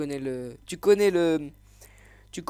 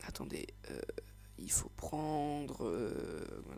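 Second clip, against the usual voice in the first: muttered to self; 3 semitones lower, 13 dB quieter, much slower than usual. Real, not acted. Muttering while searching the screen.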